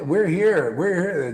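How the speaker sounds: normal talking voice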